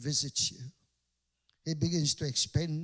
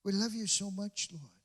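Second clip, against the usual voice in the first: soft prayer; 2 semitones lower, 6 dB quieter than usual. Retold or imitated. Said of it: "Every time we come".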